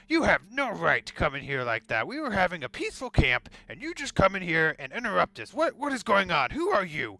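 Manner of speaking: furious dwarf scolding intruders; angry rant. Acted. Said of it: "starts angrily ranting at you".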